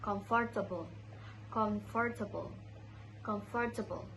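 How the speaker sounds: "comfortable" in four syllables with a full "for"; mispronounced comfortable. Shown as a demonstration. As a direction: say "comfortable" as "com-for-ta-ble". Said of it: "In my pronunciation, I said".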